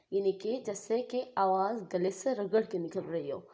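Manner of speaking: normal talking voice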